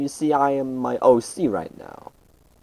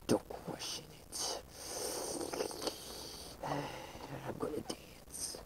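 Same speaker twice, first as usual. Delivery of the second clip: slow, much softer whisper; playful whisper; 3 semitones higher, 20 dB quieter, slower than usual. Acted. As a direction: murmur it with a sigh. Keeps whispering.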